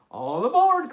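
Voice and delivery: loud, high all aboard; conductor's call. Acted. Conductor calling passengers, loud and high. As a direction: call it down the platform. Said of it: "calls the conductor".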